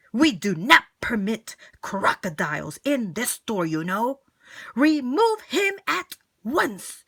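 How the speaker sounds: pompous furious Mister Grumps; angry manager. Acted. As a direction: throw them out of the store. Grumps ordering them out.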